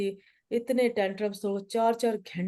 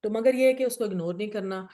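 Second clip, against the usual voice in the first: ordinary delivery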